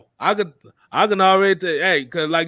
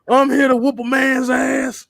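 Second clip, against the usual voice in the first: mock Stone Cold threat; 6 semitones higher than usual. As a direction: lean into the threat with a grin. Repeating Stone Cold line.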